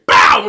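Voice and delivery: loud imitated bang of a door kicked in. Telling friends how the ex kicked in the door, loud bang. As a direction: slam the word out like a door being kicked in. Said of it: "like a Terminator".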